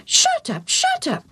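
high squawking parrot squeals it out. Acted. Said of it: "the parrot said again".

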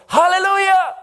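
high ringing shout of praise; loud shout. Acted. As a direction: burst out with joy, loud. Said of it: "he shouts with a loud voice".

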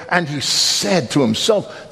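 usual speaking voice

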